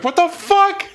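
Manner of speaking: high squealing what the fuck; shocked bystander. Retold or imitated. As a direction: shriek it in total disbelief. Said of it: "They're like".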